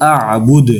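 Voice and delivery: "আ'বুদ।" ending on a bounced d, no u; stopping form. Shown as a demonstration. Teacher choosing the paused reading, "আ'বুদ।" closed on d. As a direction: stop "আ'বুদ।" on the d. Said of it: "এখানে আমরা পড়ব".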